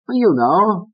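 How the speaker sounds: high coaxing voice; Fats. Acted. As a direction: needle him.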